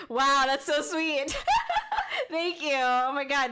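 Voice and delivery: moved, laughing and tearing up; overwhelmed by kind words; choked-up happy laughter; touched. Real, not acted. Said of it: "I'm gonna cry".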